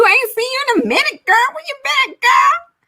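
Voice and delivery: very high, squealing welcome; excited greeting. Real, not acted. Streamer greeting a long-absent viewer with a high squeal.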